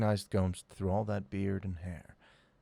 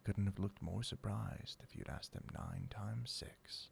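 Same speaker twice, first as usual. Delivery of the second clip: narration dropped into a whisper; 11 dB quieter than usual. A narrator's reading. Narrator describing stunned brothers, whispered. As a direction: hush it right down.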